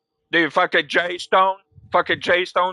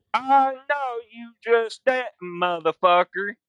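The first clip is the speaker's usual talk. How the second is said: mock tough challenge; 8 semitones higher than usual. Quoting himself in game standoff.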